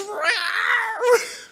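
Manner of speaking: very high, drawn-out mock yowl; loud imitation of a cat's scream; imitated cat screech. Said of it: "all I heard was".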